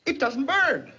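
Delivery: stunned, rising wonder; can't believe his eyes; amazed disbelief. Acted. Amazed boss repeats result.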